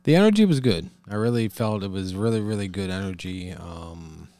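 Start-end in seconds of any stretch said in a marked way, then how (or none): 0.0-0.9 excited. Real, not acted. Excited answer.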